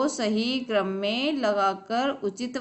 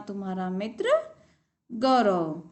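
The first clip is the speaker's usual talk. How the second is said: usual speaking voice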